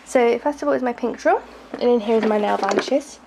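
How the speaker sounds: normal talking voice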